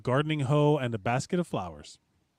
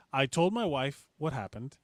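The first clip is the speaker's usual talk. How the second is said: usual speaking voice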